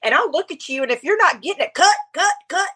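high, animated imitation of a pastor's accent; Jensen Franklin's accent. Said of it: "his accent".

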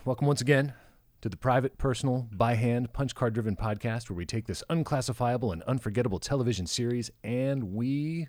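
mock-grand podcast announcer opening; announcer intro. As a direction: announce it like an old TV host.

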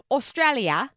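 put-on Australian accent. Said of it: "he doesn't say it like that".

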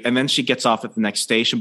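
ordinary talk, nothing marked

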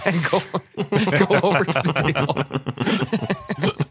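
breaking up with laughter mid-sentence; laughing. Real, not acted. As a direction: struggle to get it out through giggles. Cracks up at own story.

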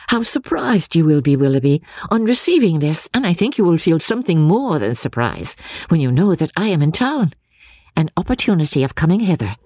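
eager Marianne letter. Acted. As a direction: read it as a hopeful young correspondent. Reads Marianne's letter in her voice.

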